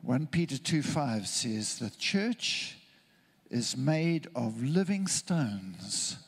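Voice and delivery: ordinary talk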